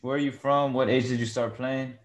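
normal talking voice

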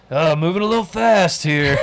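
high, loud, hammed-up nervous hedging; mock uneasy boyfriend. Boyfriend balks at a two-year girlfriend's feelings, mock unease. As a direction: play a boyfriend squirming at commitment.

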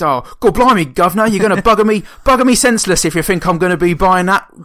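loud, high, hammed-up Cockney; mock Cockney accent. Mocking a film's fake accents, exaggerated Cockney. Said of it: "Cockney accents just seem so disingenuous".

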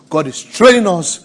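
usual speaking voice

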